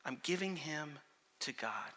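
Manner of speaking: soft, solemn drop in volume; sudden hush. Acted. Hannah hands her child over to God, hushed and solemn. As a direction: let it fall quiet after the outburst.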